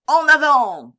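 high, loud battle cry; shouted command. A narrator's reading. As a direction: shout the order to charge. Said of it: "criait-il". (cri de commandement, fort et aigu)

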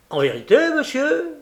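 very high, loud question; astonished courier. A narrator's reading. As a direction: react with open surprise. Courier astonished by murder news.

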